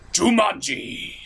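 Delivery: ordinary talk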